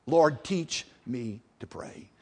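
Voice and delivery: very slow, low, soft plea; earnest prayer. Acted. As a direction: pray it word by word, quietly. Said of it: "You know what you ought to pray?".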